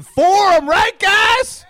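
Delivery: loud high crowd call; shouting. Acted. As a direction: yell it out to the crowd. Crowd-work shouting to audience.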